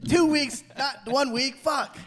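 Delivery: high-pitched, hammed-up alarm; mock panic. Caught when husband's home early, mock panic. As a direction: push the voice up in comic panic. Acting out someone caught by returning spouse.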